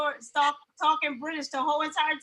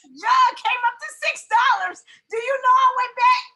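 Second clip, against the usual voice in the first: high, loud storytelling punchline; loud outburst; 6 semitones higher, 7 dB louder than usual. Real, not acted. Mother telling friend the jug cost six dollars, loud outburst.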